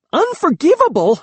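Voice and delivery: shrill, high and loud. A narrator's reading. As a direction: shriek it back in disbelief. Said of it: "the shrillness of my own voice".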